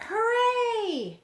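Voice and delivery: high, loud joyful cheer; cheering boy. A narrator's reading. Johnny cheering as the ship finally moves, excited cheer. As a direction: cheer it out with glee. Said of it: "Johnny cheered".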